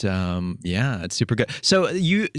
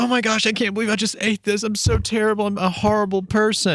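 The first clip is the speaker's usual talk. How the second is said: much higher, whiny panicked voice; mock freak-out; 10 semitones higher than usual. Host imitating a guilty fitness friend, high panicked wail. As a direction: play a friend wailing over eaten chocolate. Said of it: "They're like".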